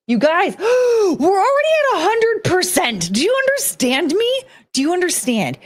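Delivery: excited. Real, not acted. Excited hundred percent reveal.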